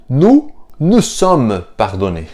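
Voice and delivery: slow, careful "nous nous sommes pardonnés"; slow model phrase. Shown as a demonstration. Teacher slowly models the double pronoun for students. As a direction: let each "nous" sound clearly. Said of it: "em francês, né, seria".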